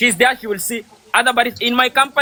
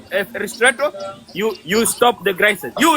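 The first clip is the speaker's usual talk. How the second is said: usual speaking voice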